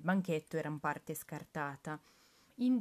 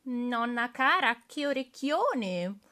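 put-on little girl; 6 semitones higher, 7 dB louder than usual. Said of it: "poi dice".

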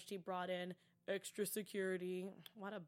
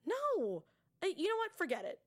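high, louder startled brush-off; shocked what then shut it down; flustered dismissal; 7 semitones higher, 3 dB louder than usual. Retold or imitated. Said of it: "Riggs was like".

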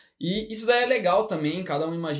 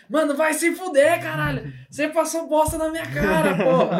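higher and much louder, hammed-up cursing yell; mock furious shout; 9 semitones higher, 4 dB louder than usual. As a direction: blow up at a friend in play. Acted player quarrel at table. (xingamento gritado de brincadeira, bem mais alto)

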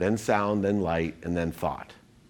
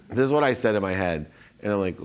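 ordinary talk

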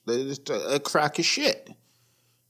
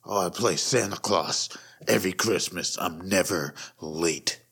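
creepy mall Santa; 9 semitones lower, 3 dB quieter than usual. Retold or imitated. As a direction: make the mall Santa sound sleazy and proud. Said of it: "What do you do for a living?".

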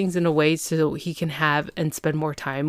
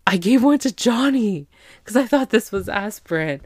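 higher, louder sudden horrified realisation; panicked confession; 5 semitones higher, 5 dB louder than usual. Retold or imitated. Kate realises she gave Johnny ecstasy, panicked and louder. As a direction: gasp it out as the penny drops.

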